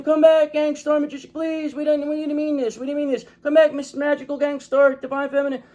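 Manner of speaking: high, whiny begging voice; hammed-up desperate calling back; mock pleading. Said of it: "everybody's chasing after you, and they're all saying".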